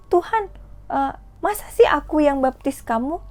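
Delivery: high, astonished puppet voice; startled protest. Acted. John the Baptist shocked that Jesus asks him to baptize, startled. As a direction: gasp it out in disbelief. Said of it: "Yohanes Pembaptis kaget".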